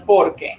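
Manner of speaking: "porque" stressed on POR, falling away; stress on first syllable. Shown as a demonstration. Teacher models Spanish because, stress on first syllable of "porque". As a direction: hit POR in "porque", let que drop. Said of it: "the emphasis is in the very first".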